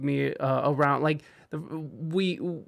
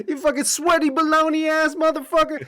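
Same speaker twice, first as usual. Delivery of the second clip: high, childish mock yelling insults; kids screaming; 10 semitones higher than usual. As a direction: squeal it like angry ten-year-olds. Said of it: "they just start screaming".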